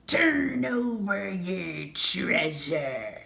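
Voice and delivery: slow, loud pirate roar; pirate captain bellowing. A narrator's reading. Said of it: "the pirate captain bellowed".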